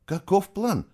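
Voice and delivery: high-pitched insistent question. A narrator's reading. Woman demanding to know the escape plan, insistent. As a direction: press for an answer. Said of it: "настойчиво повторила она".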